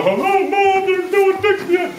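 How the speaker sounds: high-pitched mock child complaining to mom; whiny kid. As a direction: play a sad little boy calling home. Disheartened kid voiced in story.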